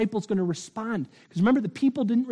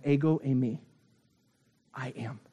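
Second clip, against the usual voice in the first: tender reassurance; 5 semitones lower, 4 dB quieter than usual. Acted. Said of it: "Jesus says to them".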